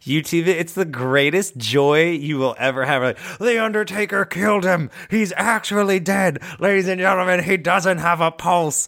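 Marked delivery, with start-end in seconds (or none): 3.4-8.9 overwrought wrestling announcer, pitched up; mock-horrified play-by-play; J R impression. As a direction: announce a disaster from ringside. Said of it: "this guy J R announce wrestling".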